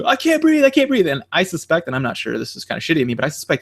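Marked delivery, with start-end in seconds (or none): 0.1-1.1 much higher, strained "I can't breathe"; hammed-up breathless plea, high; mock pleading gasp. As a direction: mimic a panicked plea, pitched way up. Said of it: "the guy's like".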